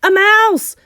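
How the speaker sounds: high, loud alarm shout; panicked crowd yell. A narrator's reading. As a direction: shriek the alarm to the whole kitchen. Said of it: "they were shouting".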